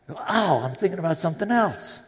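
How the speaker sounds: high, quick, caught-off-guard "oh"; a self-mocking little gasp of surprise. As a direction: play yourself realizing your mind drifted. Mind wanders during meditation.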